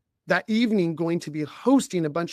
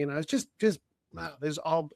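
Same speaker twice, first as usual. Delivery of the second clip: ordinary delivery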